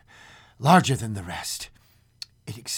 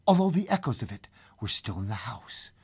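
hushed storytelling; 3 semitones higher, 4 dB quieter than usual. A narrator's reading. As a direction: let it settle into eerie quiet. Narrator's hushed aftermath.

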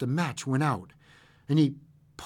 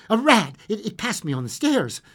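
higher, nervous stammer; shaking tones; 8 semitones higher, 4 dB louder than usual. A narrator's reading. Old man explaining the noise to his wife, shaking tones. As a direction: blurt it nervously, tripping on a word. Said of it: "said the old man in shaking tones".

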